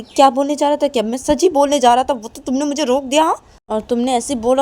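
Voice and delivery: loud, high indignant shout; angry reply. Acted. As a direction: fire it back hard. Angry reply about stopping him.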